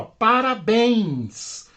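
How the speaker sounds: parabéns slowed, with BÉNS drawn out; slow teacher's parabéns, weight on the end; stress on last syllable. Shown as a demonstration. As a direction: stretch the final syllable of parabéns. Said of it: "Última sílaba aqui".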